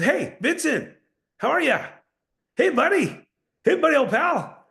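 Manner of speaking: high chummy upward tone; overly friendly. Shown as a demonstration. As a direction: gush like a salesman faking friendship.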